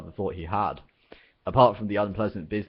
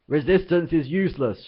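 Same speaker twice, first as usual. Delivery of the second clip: loud, higher barked slogan; shout; 9 semitones higher, 7 dB louder than usual. Acted. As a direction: bellow it like a dim guard's drill phrase. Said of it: "shouted the Vogon guard".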